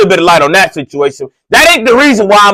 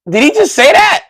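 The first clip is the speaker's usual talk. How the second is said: much higher, shocked question; shocked he just heard a slur; stunned disbelief; 11 semitones higher than usual. Retold or imitated. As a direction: ask it like you can't believe your ears.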